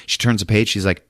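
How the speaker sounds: ordinary delivery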